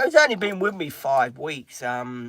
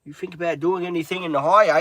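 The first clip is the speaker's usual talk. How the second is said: ordinary delivery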